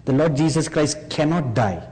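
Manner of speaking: usual speaking voice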